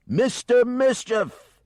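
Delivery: long, high, very loud hollered call; operatic yell. Acted. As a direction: bellow it across the waves like an opera singer. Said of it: "he yelled".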